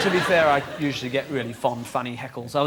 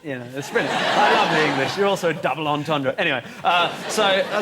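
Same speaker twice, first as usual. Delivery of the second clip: ordinary talk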